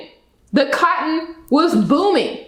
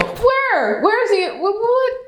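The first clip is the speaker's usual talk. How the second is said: much higher, incredulous where; sarcastic high-pitched what, what; mock disbelief; 10 semitones higher, slower than usual. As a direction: pretend to hunt for something missing. Mock searching for emotional moment.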